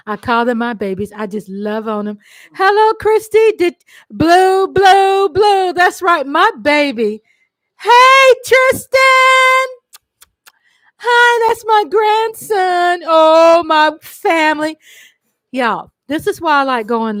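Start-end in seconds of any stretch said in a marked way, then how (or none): 2.5-9.7 higher and louder, calling out to family; light up and call each name; excited greetings. Real, not acted. Grandma greeting family in the chat, excited calls. 11.0-14.8 higher, louder, slow gushing; excited. Real, not acted. Grandma showing viewers her grandson, gushing.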